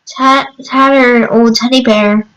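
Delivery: usual speaking voice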